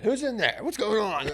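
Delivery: high mock voices of buddies banging on the door; mock friends calling out. Friends knocking on his door after graduation, high mock calling out. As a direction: pitch it way up like nosy friends outside.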